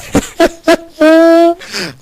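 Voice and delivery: a burst of high squealing laughter; high shrieking laugh. Real, not acted. Commentator shrieking with laughter at the champion. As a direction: cackle in disbelief, loud and high. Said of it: "you crack me up".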